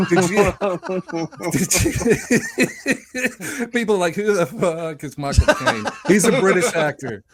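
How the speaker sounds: cracking up. Real, not acted. Co-host cracks up after his own impression, laughing hard. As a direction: struggle to speak while laughing.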